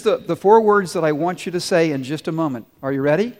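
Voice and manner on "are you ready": ordinary talk, nothing marked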